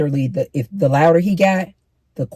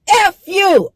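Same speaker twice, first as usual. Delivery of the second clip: much higher, louder mock curse; mock cursing yell; 6 dB louder than usual. Imitating a man swearing at her, high and loud. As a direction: spit out the curse like an angry man. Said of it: "now he using profanity".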